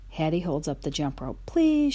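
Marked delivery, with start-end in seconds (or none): 1.4-1.9 high pleading please; begging. A narrator's reading. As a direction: beg like a child. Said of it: "she begs".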